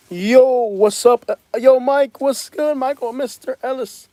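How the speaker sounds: high, drawn-out hype greeting; excited greeting. Real, not acted. Streamer welcoming Mike to the live, high excited greeting. Greeting a viewer who joins.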